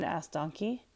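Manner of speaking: usual speaking voice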